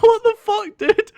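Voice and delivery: high, loud cry-laugh of disbelief; crying with laughter at the sight. Retold or imitated. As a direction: squeal it through helpless laughter. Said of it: "I'm like".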